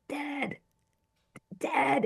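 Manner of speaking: high, soft call for dad; mildly acted weepy plea; imitating tearful plea. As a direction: re-enact a small, tearful cry for a parent. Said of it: "now I'm starting to cry".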